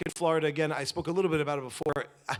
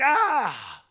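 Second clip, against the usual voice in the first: exasperated cry; 9 semitones higher, 7 dB louder than usual. Retold or imitated. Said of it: "he feels like".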